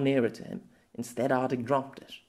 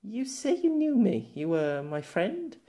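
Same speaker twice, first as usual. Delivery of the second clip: put-on higher, knowing young woman's voice; gently needling him; young girl; 6 semitones higher than usual. Girl challenging Pierre's memory of her, knowing.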